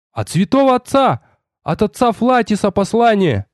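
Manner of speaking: high-pitched excited announcement; thrilled boy's exclamation; excited cry. A narrator's reading. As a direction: call it out high, bursting with news. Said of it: "возбуждённо закричал".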